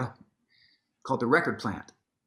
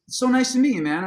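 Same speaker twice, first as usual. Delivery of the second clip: lightly voiced Prince, friendly; 10 semitones higher, 5 dB louder than usual. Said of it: "he goes".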